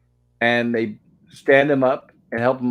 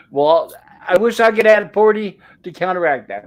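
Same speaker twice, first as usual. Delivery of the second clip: playful jab; 6 semitones higher, 6 dB louder than usual. Real, not acted. Laughing reply to forty votes.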